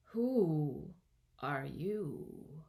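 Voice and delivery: slow, drawn-out sleepy caterpillar; drowsy caterpillar drawl; languid, sleepy. A narrator's reading. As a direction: stretch each word out languidly, with long gaps. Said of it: "addressed her in a languid, sleepy voice".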